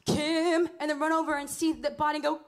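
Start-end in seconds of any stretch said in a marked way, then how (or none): 0.1-0.7 loud stage cry at the gunshot; dramatic cry. Retold or imitated. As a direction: cry out the name in mock horror. Acts castmate finding her shot.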